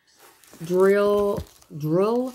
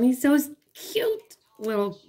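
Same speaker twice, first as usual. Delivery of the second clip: cooing over cuteness; 7 semitones higher than usual. Real, not acted. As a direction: gush like over a puppy. Cooing over a cute elephant.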